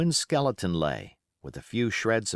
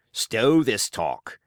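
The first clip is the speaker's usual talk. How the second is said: Silver; 4 dB louder, much slower than usual. Acted. Silver silences the men.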